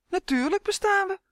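very high, squeaky little elf; crying out indignantly. A narrator's reading. Uf insists to Justin that elves exist, high indignant cry. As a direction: exclaim it high in a tiny creature voice. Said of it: "riep Uf".

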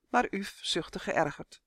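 plain reading voice

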